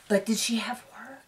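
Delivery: secretive gossip whisper. Real, not acted. Gossiping aside about surgery.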